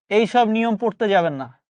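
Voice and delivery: ordinary talk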